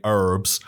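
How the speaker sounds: HERBS with a silent h, American style; mock American "herbs", drawn out. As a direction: imitate an American saying "herbs" as "erbs". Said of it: "what you would say".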